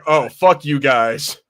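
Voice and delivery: ordinary talk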